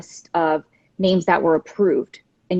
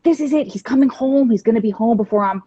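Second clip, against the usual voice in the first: higher-pitched, excited burst of hope; retold excitement; 3 semitones higher, faster than usual. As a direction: recall being thrilled at the news. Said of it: "We all got all excited".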